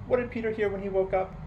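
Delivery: plain reading voice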